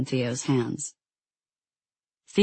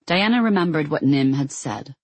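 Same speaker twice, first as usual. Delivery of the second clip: ordinary delivery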